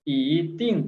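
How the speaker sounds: ई climbing into falling टिंग; second-tone ई. Shown as a demonstration. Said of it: "changes into second tone". (ईटिंग में ई दूसरी tone में चढ़ती हुई)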